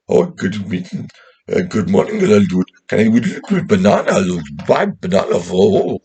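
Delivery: loud, high, swooping caricature; put-on customer voice. Man pulling up to the vegetable seller, hammed-up high voice. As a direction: ham up an over-eager greeting, high and loud.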